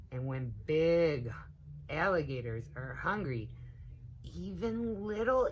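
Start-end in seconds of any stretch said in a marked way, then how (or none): none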